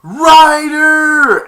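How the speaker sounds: a very high, very loud cry of the name; furious yell. Acted. Betrayed brother yelling after Flynn in a high furious shout. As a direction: scream after the thief who ran off.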